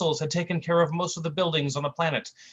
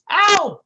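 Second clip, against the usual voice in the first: high, loud cartoon ow; goofy yelp of pain; 11 dB louder than usual. Slapped sidekick crying out, goofy high ow.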